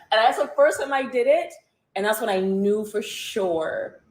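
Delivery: ordinary delivery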